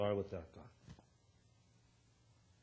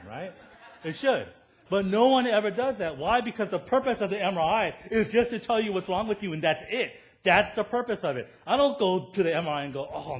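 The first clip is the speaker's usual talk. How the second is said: louder, higher, pounding preacher voice; preaching at full force; 6 semitones higher, 9 dB louder than usual. Acted. Pastor pressing the MRI point to his church, full-force preaching. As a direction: hammer the point home to the congregation.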